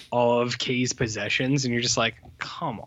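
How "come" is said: low, soft exasperated plea; mock exasperation. Viewer watching Sweetie eat Kay's possessions, quietly exasperated. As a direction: drop low and quiet in disbelief.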